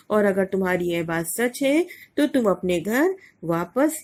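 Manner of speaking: ordinary talk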